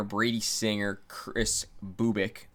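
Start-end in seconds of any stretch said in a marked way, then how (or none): 1.8-2.5 Bubic as "BOO-bik"; trying a pronunciation. Real, not acted.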